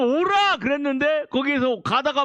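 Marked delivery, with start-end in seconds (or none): 0.0-0.6 high loud call of come; Jesus calling. Acted. Jesus calling Peter onto the water, loud high call. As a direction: summon him across the water.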